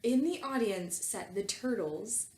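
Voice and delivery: normal talking voice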